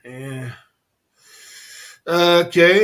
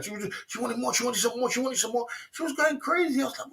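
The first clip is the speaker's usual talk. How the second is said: usual speaking voice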